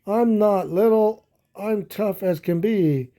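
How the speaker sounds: high, loud defiant shout; a little buffalo shouting that he's not little. Acted. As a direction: yell it back, insisting you're tough. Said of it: "Fluff shouted out".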